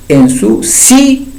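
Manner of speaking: "ensuci-" very slow, holding the final í; stressed final I. Shown as a demonstration. Teacher pointing out the accent on the I, "ensuci-" stretched on í. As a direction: stop on a long stressed í in "ensuci-". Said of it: "ahí está el acento ortográfico en la I".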